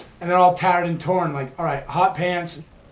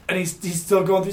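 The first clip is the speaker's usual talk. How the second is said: usual speaking voice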